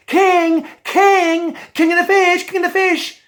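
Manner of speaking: high, loud summoning call. Acted. Dolphin calling the King of the Fish, a high loud call.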